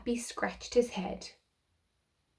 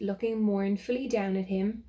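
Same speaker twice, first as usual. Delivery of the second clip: plain reading voice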